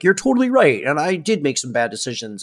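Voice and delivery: lightly voiced apology; mock repentant father. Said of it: "dad had been like".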